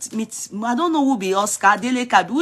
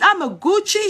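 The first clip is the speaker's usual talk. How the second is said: lightly raised quote of her brag; mock quote; 9 semitones higher than usual. Commentator quoting the girl's brag to followers, mildly mocking. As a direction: echo her line with a hint of mockery. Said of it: "she said it".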